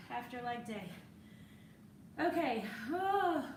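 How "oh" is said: mid-yawn oh. Real, not acted. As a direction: yawn through the word. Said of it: "I'm sorry I'm yawning".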